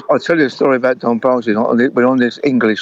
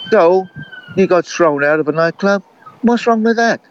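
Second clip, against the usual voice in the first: mock carefree shrug, pitched way up; breezy star voice; 8 semitones higher than usual. [name] cheers up the band.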